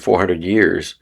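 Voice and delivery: ordinary delivery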